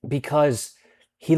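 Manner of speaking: normal talking voice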